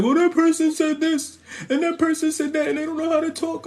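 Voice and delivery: a touch of a nagging busybody; mocking a whiny gossip. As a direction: whine it like a petty complainer. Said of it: "if you a person that's always like".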